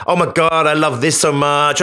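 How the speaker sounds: imitated American gushing; mock American oh-my-God voice; American accent. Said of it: "doing the American accent".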